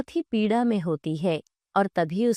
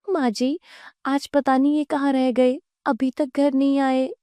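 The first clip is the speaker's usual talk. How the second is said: higher-pitched, acted worry; worried wife; 4 semitones higher, 3 dB louder than usual. Wife asking mother-in-law about her missing husband, worried. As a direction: voice an anxious daughter-in-law. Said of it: "घबरा जाते हैं".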